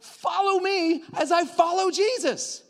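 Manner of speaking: high, loud and slow, proclaiming; rousing preacher's cry; loud preached call. Shown as a demonstration. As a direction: call it out to the whole room. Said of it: "look at the people around you and go".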